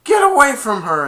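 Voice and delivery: loud, high villainous command; Ursula. Retold or imitated. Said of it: "Ursula got her old voice back and said".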